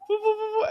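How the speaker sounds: high, airy foo foo foo; doctor blowing on the stretched skin, high breathy foo; doctor's blowing noise. Retold or imitated. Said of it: "She goes".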